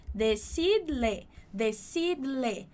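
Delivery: "decidle" slow, with the D clearly separate from "le"; teacher modelling the D sound; careful slow demo. Shown as a demonstration. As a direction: pull "decidle" apart gently: de-cid-le. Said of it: "pra você não juntar isso tudo em um ble".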